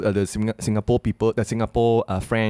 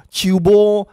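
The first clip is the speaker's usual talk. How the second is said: higher, louder mock exclamation; a louder, higher Hokkien slang quip; 9 semitones higher, 7 dB louder than usual. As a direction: toss off the Hokkien phrase loudly. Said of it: "and be like".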